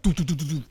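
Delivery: loud playful doo doo noises imitating a shoe toss; bursting out with rhythmic doo sounds; mock throwing sounds. Host imitating classmates tossing the shoe, loud playful doo sounds. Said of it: "doing this, you know, pulling the shoe off".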